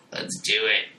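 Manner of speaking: voiced, lightly monstrous father; creepy voice. Monstrous father leering at Jane, lightly voiced creepy tone. Same line repeated voiced.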